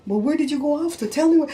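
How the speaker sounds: high, rising imitated demand; hammed-up jealous questioning; mock angry husband. Wife imitating her husband's angry questions. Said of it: "he'd be mad".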